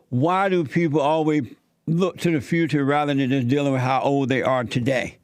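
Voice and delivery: normal talking voice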